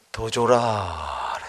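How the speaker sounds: very low, slow voice from above; God's voice. Retold or imitated. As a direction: speak slowly and deeply as God. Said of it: "뒤에서 음성이 들리는 거예요".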